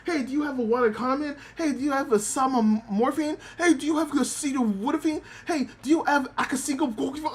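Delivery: high dog voice botching acetaminophen; dog mangling the word. Retold or imitated. Muchan begging for medicine for Corey, high and mispronouncing. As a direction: pitch up and stumble over the medicine name like a puppy. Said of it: "the dog cannot pronounce acetaminophen".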